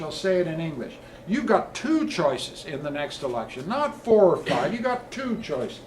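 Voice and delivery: loud, high, building political oratory, punching the key words; rousing rally speech. Real, not acted. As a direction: preach it to a snowy town hall crowd like a campaign leader. Rally stump speech to crowd.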